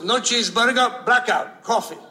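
clipped verdicts from a stubborn Greek cook; Greek diner owner. Retold or imitated. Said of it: "He goes".

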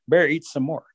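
ordinary delivery

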